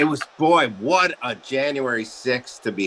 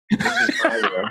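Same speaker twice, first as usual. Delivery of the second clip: laughing high squeal. Real, not acted. Guest showing host his room, laughing. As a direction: laugh through it.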